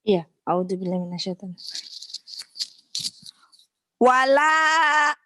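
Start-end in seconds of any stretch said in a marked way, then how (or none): 4.0-5.1 loud, drawn-out wala; loud recitation. Real, not acted. Pupil reciting to her teacher, loud and drawn out. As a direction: belt out the long chanted opening. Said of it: "Keras ya".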